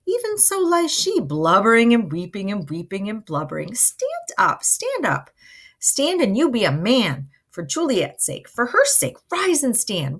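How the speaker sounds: high, emphatic dramatic urging; exhorting Nurse. Acted. Nurse tells weeping Romeo to stand up, high and insistent. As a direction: lift the voice sharply on each command.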